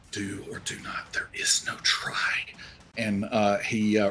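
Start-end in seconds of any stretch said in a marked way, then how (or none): 0.1-2.4 whispered self-talk; muttering to himself. Acted. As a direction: mutter it under your breath, for your ears only. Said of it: "kinda muttering to himself".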